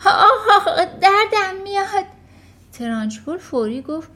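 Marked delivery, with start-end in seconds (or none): 0.1-2.1 loud, high pained yelps; crying out in pain. Acted. Boy yelping in pain as his ears are pulled. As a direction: yelp as your ears are twisted. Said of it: "اریک داد زد".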